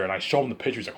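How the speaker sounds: normal talking voice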